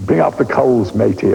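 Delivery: a hint of a music-hall Cockney coalman calling; imitating his old bad Cockney coalman record; terrible Cockney accent. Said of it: "I say in a terrible Cockney accent".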